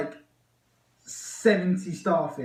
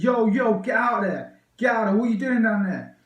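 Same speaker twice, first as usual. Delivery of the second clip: higher, louder mock police shout; imitated shouting; 6 semitones higher, 5 dB louder than usual. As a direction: call out like someone ordering people off. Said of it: "shouting, like".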